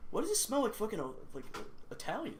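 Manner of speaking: high squeaky confused stoner; stoned puzzled voice. Retold or imitated. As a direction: pitch way up, baffled and high. Acting out stoned self.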